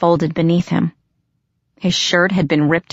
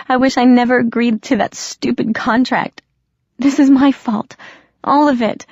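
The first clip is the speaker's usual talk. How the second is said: a tearful confession spilling out, pitched up in distress; tearful apology; 6 semitones higher than usual. Acted. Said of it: "and now I did start to cry".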